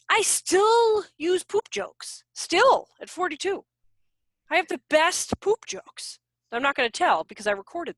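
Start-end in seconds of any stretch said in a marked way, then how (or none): 0.1-6.2 silly playful boast. Real, not acted.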